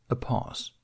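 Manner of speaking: plain reading voice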